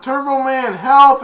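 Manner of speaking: high, loud call to a hero; mock-frightened shout; cry for help. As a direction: yell for rescue like a movie kid.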